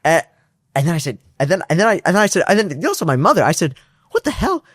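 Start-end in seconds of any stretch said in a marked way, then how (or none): 4.1-4.6 amazed outburst. Retold or imitated. Retold amazement at mom.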